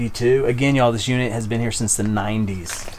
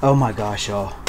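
ordinary talk

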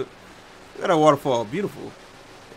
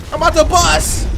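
louder hype; 8 semitones higher, 8 dB louder than usual. Real, not acted.

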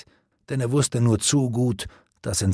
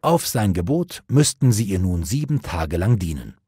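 ordinary delivery

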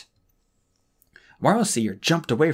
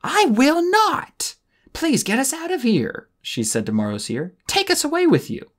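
defiant plea; 4 dB louder than usual. A narrator's reading. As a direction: defy the guardian, then beg. Tsunami defying, begging Moroseer.

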